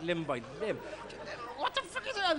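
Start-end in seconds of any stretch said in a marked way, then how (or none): 1.6-2.4 put-on high outraged kid. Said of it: "you're going".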